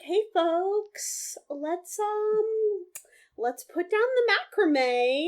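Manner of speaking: high-pitched mock camp counselor; put-on sing-song counselor voice. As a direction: chirp it like a counselor herding campers. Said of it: "sound like a camp counselor".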